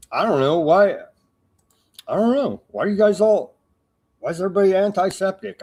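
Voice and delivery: high, fast, mock-baffled squeak; mock innocent whine. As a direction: play dumb in a squeaky mocking voice. Mocking questions about hatred.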